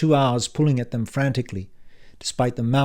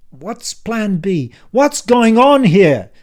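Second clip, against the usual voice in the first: much higher, loud, slow panicked yell; 10 semitones higher, 11 dB louder, much slower than usual. Acted. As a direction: demand answers at the top of your voice. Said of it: "Stop yelling".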